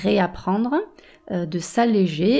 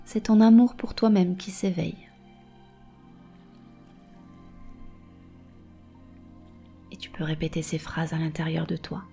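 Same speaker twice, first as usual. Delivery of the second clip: right in your ear. Acted. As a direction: breathe calm into every phrase. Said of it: "Je vais te guider dans une méditation".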